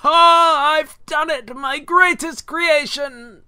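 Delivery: loud, slow, high triumphant cackle-voice; mad scientist. Acted. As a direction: gloat over your creation, booming. Promo skit, scientist triumph.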